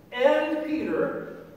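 AND drawn out slow and high before Peter; stress on AND. Acted. Angel singles out one disciple for the women, stress on AND. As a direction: lean long and high on "and".

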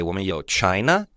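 usual speaking voice

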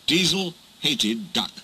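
ordinary delivery, nothing marked

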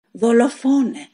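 high, loud cry of murderer; screaming accusation. A narrator's reading. Wounded wife screams at Pedro, accusing him furiously. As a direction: hurl the word at him. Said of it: "φώναξε τέλος η γυναίκα".

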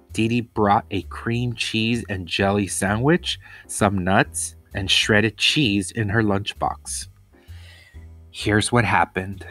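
plain reading voice

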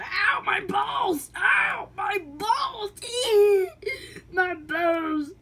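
high pained howling, slowed; just hit in the groin. Acted. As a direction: wail in groin pain.

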